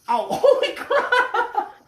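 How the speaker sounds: high, slow startled yelp with a laugh; jolted by the spikes; laughing shock after a poke; real shock. Real, not acted. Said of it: "don't ever do that".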